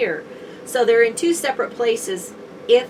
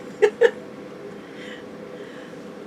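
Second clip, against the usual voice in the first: breathy laugh; 13 semitones higher than usual. Real, not acted. Laughs at being shushed.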